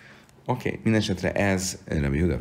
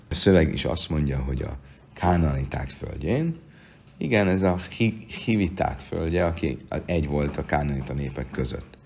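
ordinary delivery

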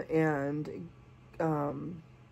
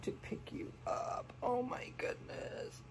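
whispered, higher at the end; awed whisper; 6 semitones higher, 12 dB quieter than usual. Real, not acted. Lifting the baby up, a whispered gush. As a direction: breathe it out in tender wonder. Picking up the doll.

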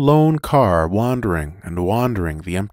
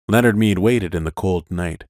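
usual speaking voice

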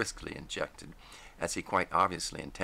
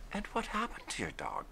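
higher voice; Gwen; 4 dB quieter than usual. A narrator's reading. Gwen gently asking Christy about his dog, higher voice. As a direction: ask gently, pitched up.

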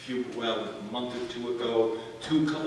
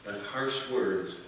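soft, slow reading; 5 semitones lower, 7 dB quieter, slower than usual. Shown as a demonstration. Reading key verse slowly, softly.

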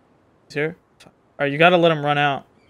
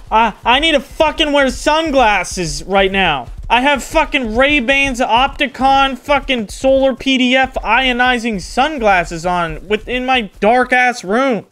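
exasperated rant; 7 semitones higher, 6 dB louder than usual. Real, not acted. Ranting about sun glare.

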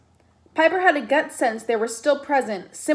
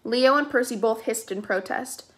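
ordinary delivery

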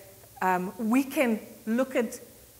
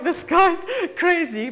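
mock aside; 8 semitones higher, 6 dB louder, slower than usual.

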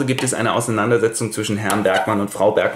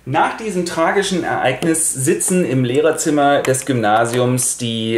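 ordinary talk, nothing marked

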